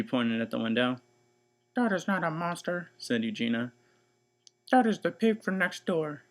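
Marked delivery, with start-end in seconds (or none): 1.7-6.2 put-on 1 stern older sister. Eugenia voice.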